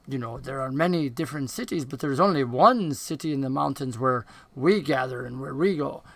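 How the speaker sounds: soft goblin voice, lightly put on; dark goblin explaining. Dark goblin telling a stranger of the mountain city, soft and measured. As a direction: explain it quietly, with lifts on key words.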